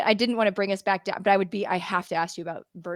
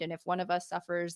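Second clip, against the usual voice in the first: usual speaking voice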